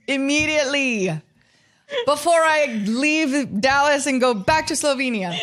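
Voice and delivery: lightly voiced Slovenian accent with a laugh; mock demand in a hint of Slovenian; giggling Slovenian accent impression. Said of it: "in a Slovenian accent".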